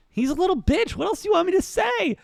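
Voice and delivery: high-pitched, exasperated protest; exasperated whine. Real, not acted. Host defending his Rory rant to co-host, exasperated. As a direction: push it way up high, pleading your case.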